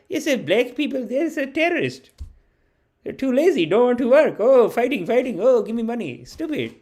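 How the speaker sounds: put-on high-pitched, scornful uncle in a Patel family accent. Uncle ranting about lazy protesters, mock Patel uncle accent. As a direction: mimic a scolding Patel uncle, pitched well up. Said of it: "I had uncles that'd be like".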